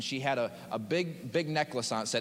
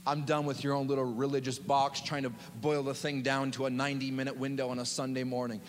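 normal talking voice

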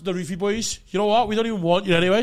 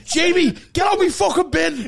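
yelling at a bin raider; angry shout; 9 semitones higher, 5 dB louder than usual. Pretend. Mock angry neighbour shouting.

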